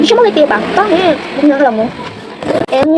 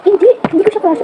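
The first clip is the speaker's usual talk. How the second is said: laughing denial; 6 semitones higher than usual. Retold or imitated. Denying a crush, laughing.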